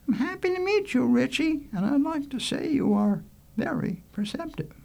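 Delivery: put-on high-pitched, gracious girl; blushing Joy. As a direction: greet the boy politely in a high voice. Joy greets Richie.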